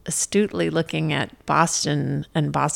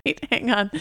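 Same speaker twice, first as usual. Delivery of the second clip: ordinary talk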